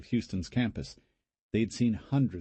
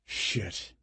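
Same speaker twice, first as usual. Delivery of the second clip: muttered curse; 3 dB quieter than usual. A narrator's reading. Brent sees the wall.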